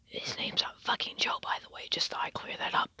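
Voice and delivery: unvoiced whisper, fast and low; hushed aside. Real, not acted. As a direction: lean in and whisper it off to the side. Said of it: "whispered aside about the fake name".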